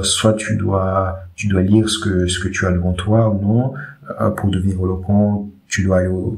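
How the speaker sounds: quiet, faltering, lost for words; unsure, hesitant. Shown as a demonstration. Coach showing how a speaker without confidence sounds, hesitant and quiet. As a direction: mumble as if you have no confidence. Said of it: "si je parle comme ça".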